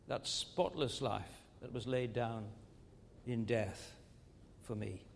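a tender, reverent hush after the climax. Acted. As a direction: let it fall to a hush.

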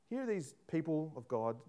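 usual speaking voice

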